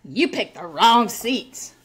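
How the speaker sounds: put-on drawn-out, slow taunt in a girl's voice; mocking imitation. Rude fan to woman in front, mocking imitation. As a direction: imitate her, stretching it out slowly. Voicing rude girl behind her.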